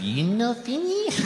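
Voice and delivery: put-on high, slow Japanese-accented question. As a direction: mimic the waitress's accent, lifting high. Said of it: "She said".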